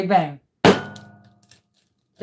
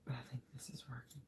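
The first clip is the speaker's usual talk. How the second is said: whispering; 4 semitones lower, 30 dB quieter, faster than usual. Real, not acted. Hushed hope mid-pour.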